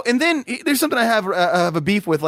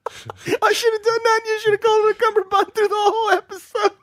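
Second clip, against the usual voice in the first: higher, laughing through the words; 13 semitones higher than usual. Real, not acted. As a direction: giggle out the idea. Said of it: "laughing while talking".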